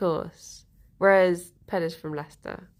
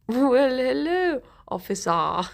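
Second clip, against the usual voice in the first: hammed-up posh lady greeting police; higher, posh hello falling away; put-on posh accent; 3 semitones higher, 4 dB louder, slower than usual. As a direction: play innocent in a posh accent. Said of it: "She is posh".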